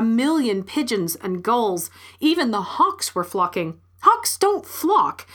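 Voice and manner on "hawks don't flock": high, slow, emphatic disbelief; incredulous protest. A narrator's reading. Woman sees hawks flocking, high incredulous protest. Narrator protests at impossible sight.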